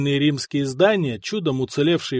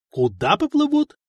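much higher, jaw-dropped question; astonished; 13 semitones higher than usual. A narrator's reading. Kosta hears the actors must sail up the Nile, astonished. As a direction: blurt it out in disbelief. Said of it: "открыл рот Коста".

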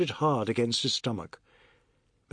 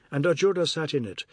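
ordinary delivery, nothing marked

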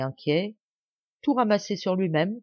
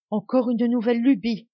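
moaning complaint; 6 semitones higher, 4 dB louder than usual. A narrator's reading. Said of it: "gémissait la parente pauvre".

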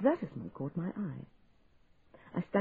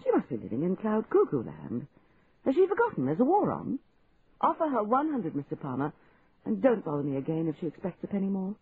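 indignant; 5 semitones higher, 4 dB louder than usual. A narrator's reading. Annoyed customer scolding clerk.

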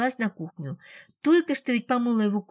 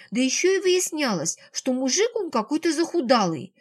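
plain reading voice

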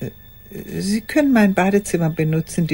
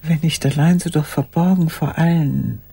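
ordinary talk, nothing marked

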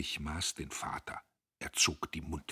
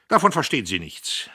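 loud, higher dismissal; condescending; 8 semitones higher, 12 dB louder than usual. Acted. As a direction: put him in his place. Diederich herablassend. (herablassend und laut)

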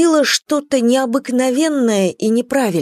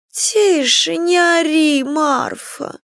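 irritated hush; 7 semitones higher, much slower than usual. Acted. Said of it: "поморщилась Елена Григорьевна".

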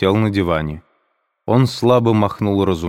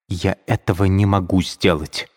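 plain reading voice

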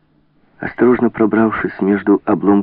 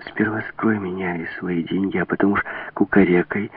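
plain reading voice

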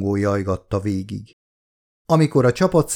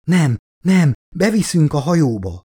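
raised, anxious acted pleading; frightened denial, pitched up; deathly frightened; 6 semitones higher than usual. A narrator's reading. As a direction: voice mild terror, without whispering. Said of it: "súgta Elizabeth halálra vált hangon".

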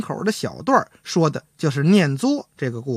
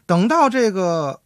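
ordinary talk, nothing marked

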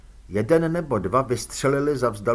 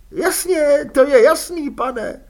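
high, loud hurried yes-sir; eager servile agreement; 10 dB louder than usual. A narrator's reading. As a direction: grovel with relief. Fredka eager relief.

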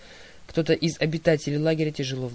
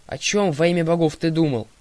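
much louder furious demand; angry growl; 3 semitones higher, 7 dB louder than usual. A narrator's reading. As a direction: snarl it at him in rage. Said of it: "проворчала Талия".

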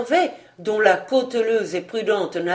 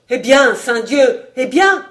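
loud oath, rising higher; impatient demand; 4 semitones higher, 7 dB louder than usual. A narrator's reading. Vaunois presses the majordomo to go on, impatient. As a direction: press hard for the answer.

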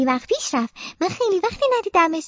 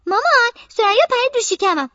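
higher whiny complaint to mum; tattling child; 8 semitones higher, 3 dB louder than usual. Acted. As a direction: tell on her friend to mother.

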